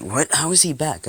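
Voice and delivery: high, loud disbelief; shocked outcry. Acted. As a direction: blurt it out in shock. Class shocked at his return.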